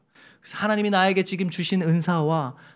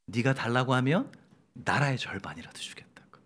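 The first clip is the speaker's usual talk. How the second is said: soft, lower, hushed retelling of the king's words; quiet whispered version of the grand decree; whispered royal offer; 5 semitones lower, 6 dB quieter than usual. Shown as a demonstration. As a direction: breathe the promise gently. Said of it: "unvoiced whisper".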